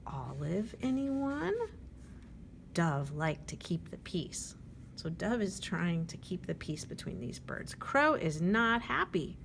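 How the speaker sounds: plain reading voice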